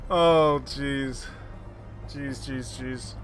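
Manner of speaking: ordinary delivery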